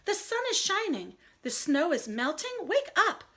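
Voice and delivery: high, coaxing Frog voice; eager Frog. Acted. Frog coaxing sleepy Toad outside, high eager voice. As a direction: coax him awake, high and bright.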